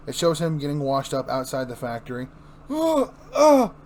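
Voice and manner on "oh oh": high, slow pained cries; stung all over, groaning through it. Acted. Joker burned by acid.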